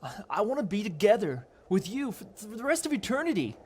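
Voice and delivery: put-on high-pitched, impassioned young lover; urgent pleading. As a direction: plead your case, high and insistent.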